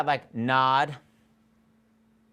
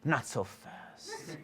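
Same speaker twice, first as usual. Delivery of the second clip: very soft, drawn-out aside; slow and hushed, halting someone; stopping someone leaving; 10 dB quieter, much slower than usual. Shown as a demonstration. Coach acting out seeing someone leave, soft and slow. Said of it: "you do see someone leaving".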